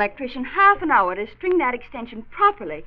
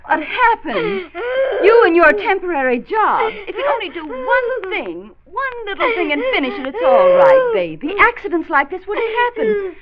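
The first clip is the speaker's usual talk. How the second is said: higher, tearful reproach; upset scolding; 7 semitones higher, 5 dB louder than usual. Acted. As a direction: scold him through tears. Wife blames husband after accident.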